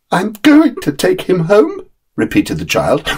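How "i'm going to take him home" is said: put-on high, slow, firm child's voice; determined little boy. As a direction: dig in, won't be moved. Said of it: "with a determination which I heartily admired".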